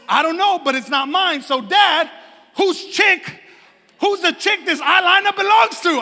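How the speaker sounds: high, loud imitation of an accusing daughter; hammed-up kid interrogating Dad; wife's little minion. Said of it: "her little Lisa minion".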